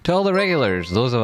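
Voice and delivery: sudden high sing-song greeting; high call-out. Real, not acted. Streamer hails his regulars in a high call-out. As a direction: pitch it way up to hail the regulars.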